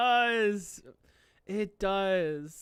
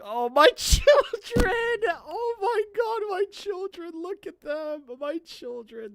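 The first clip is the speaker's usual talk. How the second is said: very high wail, fading as it goes; tearful adoring squeal; 11 semitones higher, 5 dB louder than usual. Real, not acted. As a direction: wail adoringly, from loud cry to small squeak. Gushing at beloved characters on screen.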